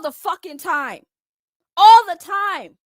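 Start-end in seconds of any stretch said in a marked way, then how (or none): 1.7-2.8 louder and slower, each word hammered; shouted emphasis. Real, not acted. Fuming about fans using a dead man's name, slow shout.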